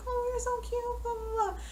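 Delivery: put-on high, sing-song baby talk; cooing at puppies. She coos at the party dogs in high baby talk. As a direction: coo at the puppies like they're babies.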